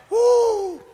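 high-pitched preacher's whoop; excited whoop. Real, not acted. Preacher thrilled by his own message, excited whoop. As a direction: let out a high, thrilled "woo".

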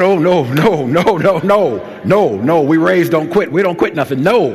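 loud, high volley of protest; emphatic fatherly refusal. Retold or imitated. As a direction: reenact a dad firmly putting his foot down. Father refusing son's quitting.

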